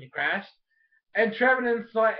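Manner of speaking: ordinary talk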